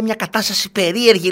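high mock voice of a stressed mother; lightly mimicking the anxious woman; imitating a woman. Host imitates anxious mother telling her news, high mock voice. Acquaintance quoted, high put-on voice.

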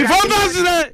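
very high-pitched shout back; yelled rebuke. Real, not acted. Host tells off a caller for shouting in the morning, yelling.

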